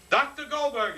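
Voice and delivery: high, startled call of the name; surprised recognition. Retold or imitated. Little man on the bus recognizing the doctor's exercise, high surprised call. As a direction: blurt it out as he recognizes the trick. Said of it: "a little man jumps up and he goes".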